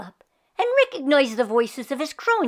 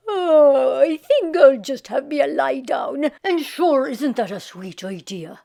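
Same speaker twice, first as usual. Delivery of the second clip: lazy Irishman; 2 semitones higher, 4 dB louder than usual. Acted. Jamie to himself.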